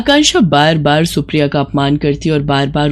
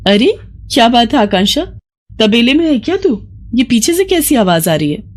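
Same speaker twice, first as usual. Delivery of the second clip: higher, amused surprise; teasing her friend about a noise, a bit higher; surprised friend; 5 semitones higher than usual. Acted. Friend on the phone hearing a bull, surprised.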